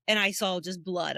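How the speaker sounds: normal talking voice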